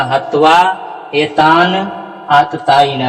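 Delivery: हत्वा एतान आतताइन word by word; correct recitation. Shown as a demonstration. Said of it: "यहाँ उच्चारण क्या होगा?".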